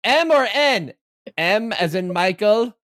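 high, slow, mocking question to the drivers; mocking announcer voice. Mocking the race team offering strategy, high and slow. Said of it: "They're like".

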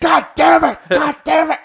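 high, loud furious cursing; frustrated yell. Retold or imitated. Fan cursing at the TV after the play, loud and high. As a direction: explode at the bad play. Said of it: "Or, like, I was like".

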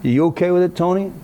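playful challenge, voice lifted high; teasing prod. Retold or imitated. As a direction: poke at the silent friend. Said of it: "teasing question to Tony".

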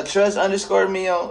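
usual speaking voice

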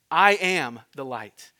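slow, loud, weighty I am; measured, slow solemn pronouncement; solemn declaration. Acted. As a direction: proclaim each word deliberately. Preacher voicing Jesus' claim.

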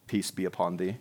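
very low, hushed monotone benediction; mock-solemn blessing. Pastor parodies church folk greeting each other, mock-solemn. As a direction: intone it with exaggerated piety, deep and soft.

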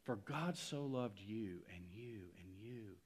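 soft and slow, each you pressed; tender emphasis. Acted. Preacher telling each listener God loves them, slow and soft. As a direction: point at each person gently.

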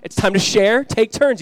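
mimicked parent laying down the law; imitated parental commands. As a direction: call out orders like a parent upstairs. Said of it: "hear some commands being given".

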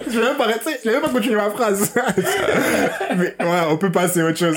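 high, laughing through the words. Real, not acted. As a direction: talk through the giggles. Still laughing while talking. (en riant)

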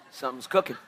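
quiet aside. Real, not acted. Playful aside about bread baking.

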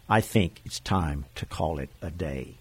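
low, soft, tired inner thought; weary inner voice. Retold or imitated. Jesus weary after healing crowds, quiet and tired. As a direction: let it sag like a long day's end.

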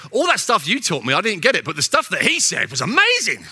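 high, loud, gushing imitation of an enthusiastic churchgoer; gushing congregant. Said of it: "they come and see me and say".

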